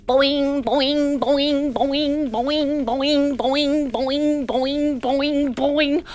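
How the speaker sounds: bouncy repeated boings like a kangaroo; boing sound. Shown as a demonstration. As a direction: chant each boing with a little hop. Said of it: "Let's do some bouncing about".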